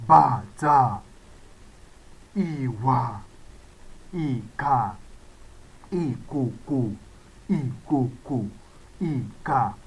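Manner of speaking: "bàtà", "ìwà", "ìkà" kept low and falling; low tone words. Shown as a demonstration. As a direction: sound each word deep on the low tone. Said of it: "All of them is do".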